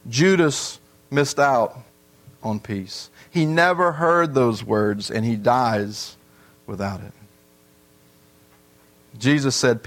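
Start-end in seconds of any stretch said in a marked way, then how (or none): 0.1-7.1 solemn. Real, not acted. Solemn preaching close.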